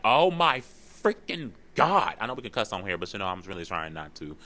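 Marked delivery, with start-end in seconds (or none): 0.0-2.2 drawn-out, much higher and louder fed-up cry; slow, exasperated oh my freaking God; exasperated groan. Retold or imitated. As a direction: stretch it out like you're sick of being sick. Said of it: "I'd be like".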